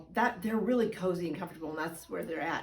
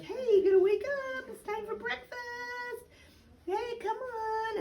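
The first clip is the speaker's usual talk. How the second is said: put-on high, sing-song coaxing to a sleepy baby; cooing wake-up voice. Shown as a demonstration. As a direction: coo the baby awake, lilting and high. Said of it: "I'll even be like".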